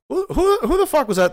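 high, loud mock outrage; CO's hammed-up indignant demand. CO demands who spoke.

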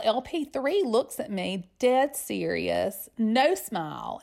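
ordinary talk

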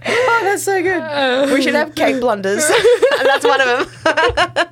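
high, drawn-out words through laughter; real giggling fit; laughing hard. Real, not acted. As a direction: talk while cracking up. Still cracking up over the slip.

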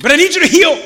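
put-on high, loud childish whine; whiny impatient believer. Believer complaining to God, high and whiny. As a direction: beg like a kid who can't wait.

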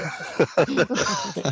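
laughter. Real, not acted. Laughing at the joke.

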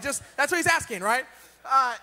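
ordinary delivery

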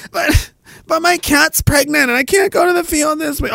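high-pitched wailing excuse; mock whining. Soldier begging off field exercise, hammed-up whine. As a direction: plead feebly in an exaggerated whine.

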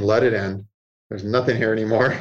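low, settled advice; calm inner voice. Acted. Inner voice urging letting go, low and calm. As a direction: drop the pitch, matter-of-fact. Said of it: "my inner voice saying".

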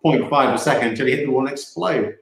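normal talking voice

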